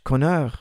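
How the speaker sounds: Conor said the French way, kon-AR with a throaty French r; Parisian French name. Shown as a demonstration. Coach says a fan's name in French. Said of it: "that would be too Parisian".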